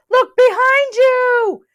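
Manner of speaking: very high, loud, slow warning; alarmed gasp. A narrator's reading. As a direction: yell it out in alarm. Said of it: "gasped Rabbit".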